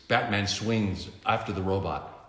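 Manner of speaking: plain reading voice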